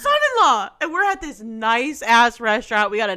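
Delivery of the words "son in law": a high, much louder blurted shout; mock drunk yell. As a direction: blurt it out like a tipsy friend. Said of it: "She goes".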